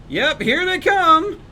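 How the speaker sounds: high, loud and drawn out, thrilled; excited cheer. Real, not acted. Streamer cheering the parts arriving at base, excitedly high and loud. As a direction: cheer them in as they finally show up.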